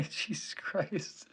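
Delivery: laughing slowly through the words; laughing in disbelief. Real, not acted. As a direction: chuckle at something absurd. Reacting to weird AI image.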